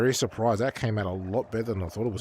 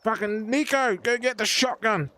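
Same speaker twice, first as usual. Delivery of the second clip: mock angry yelling; 12 semitones higher, 3 dB louder than usual. Mock angry shouting at prankster.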